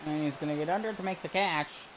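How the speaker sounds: brisk announcer call of the play. Acted. Streamer calling his own video game, play-by-play voice. Calls walk and fly ball.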